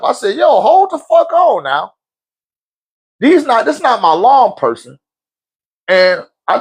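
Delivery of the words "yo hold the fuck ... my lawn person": mock outrage. Retelling his outburst at wife.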